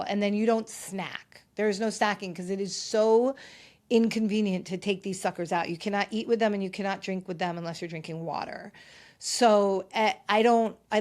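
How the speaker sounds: normal talking voice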